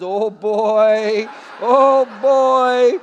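drawn-out mock dismay; comic dread. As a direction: stretch it out as if caught and doomed. Acted dread at being found out.

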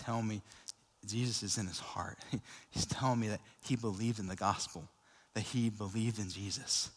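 whispered, fighting back tears; choked whisper. Real, not acted. Pastor recalling the boy's new faith, choked whisper. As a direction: barely voice it, moved to tears.

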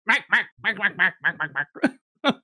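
lightly voiced, yappy mac-mac babble; kobold chatter. GM showing how Draconic sounds, yappy kobold babble. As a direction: babble like a squeaky lizard. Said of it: "this is all just".

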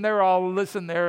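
ordinary delivery

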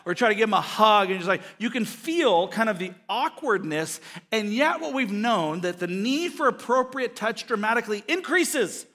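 ordinary delivery

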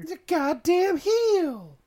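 very high, slow hammed-up jeer; mock heckling fan. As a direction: scream it like a rowdy fan. Heckle escalates.

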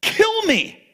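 high, pleading cry of despair; Jonah's plea. Acted. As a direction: beg for death like a whining prophet. Jonah voiced begging to die.

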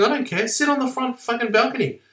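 high-pitched imitation of a fed-up girlfriend; mock angry girlfriend. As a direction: snap it high, as the furious girlfriend. Angry girlfriend throwing him out.

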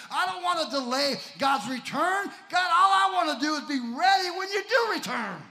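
high, loud shouted preaching; rising preacher's shout calling for amen; preaching climax. Acted. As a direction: build up and shout it to the church.

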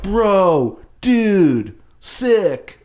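drawn-out, high, loud surfer-bro exclamations; hammed-up surfer slang, each word stretched out and falling; surfer dude. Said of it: "they're, they're surfers".

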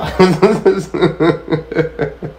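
a loose real laugh at the punchline; amused laugh. Real, not acted. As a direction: laugh it off at the friend's turn. Laughs at his own story.

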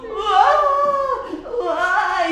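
high wailing "uá"; mock crying. A narrator's reading. Little girl in the tree wailing, mock crying. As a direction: wail like a small child. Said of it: "a menina chorou".

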